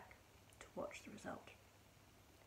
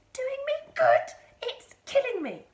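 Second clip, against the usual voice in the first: screaming; 16 dB louder than usual. A narrator's reading. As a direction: scream in disbelief at full volume. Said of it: "she screamed".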